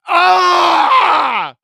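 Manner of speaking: long, loud high cry of protest; exasperated yell. Real, not acted. Streamer reacting to a corny joke, exasperated yell. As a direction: yell "Ah" as if pained by a terrible pun.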